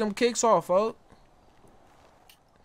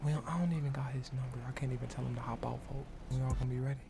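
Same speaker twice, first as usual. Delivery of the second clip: hushed plotting; 10 semitones lower, 14 dB quieter than usual. Pretend. Plotting an ambush quietly.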